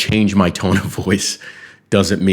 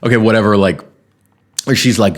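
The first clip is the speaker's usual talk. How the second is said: normal talking voice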